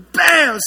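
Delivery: shouted crash sound, high and loud. Retold or imitated. Pastor imitating car hitting pillar: loud shouted bang. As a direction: belt out the bang of the impact.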